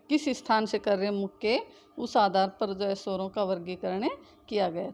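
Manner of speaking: ordinary talk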